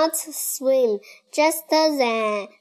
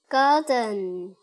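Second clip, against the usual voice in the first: ordinary delivery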